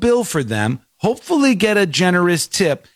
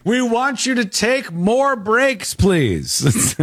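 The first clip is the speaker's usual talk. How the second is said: mock manager; 8 semitones higher, much slower than usual. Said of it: "mocks manager asking for breaks".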